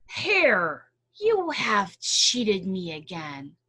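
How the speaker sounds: loud, slow accusing growl; angry bear. Acted. Bear confronting Hare over the cheat, slow and angry. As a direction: drag out the accusation.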